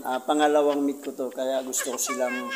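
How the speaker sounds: ordinary talk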